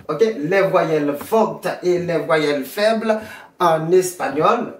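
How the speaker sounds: ordinary talk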